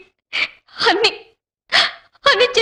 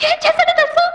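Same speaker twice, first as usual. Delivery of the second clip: very high, indignant question; worked-up indignation; 8 semitones higher than usual. Acted. Wife complaining to her husband about his brother, very high and indignant. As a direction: pitch it right up, demanding he guess.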